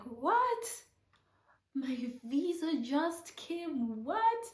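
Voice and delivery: high, stunned "What?" with a slow disbelieving middle; reliving the moment the news landed; squeaky astonished replay; shocked disbelief. Retold or imitated. Shocked reaction to visa news.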